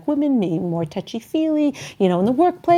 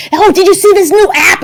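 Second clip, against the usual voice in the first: put-on old person on iPhone; 10 semitones higher, 10 dB louder than usual. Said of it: "so they say".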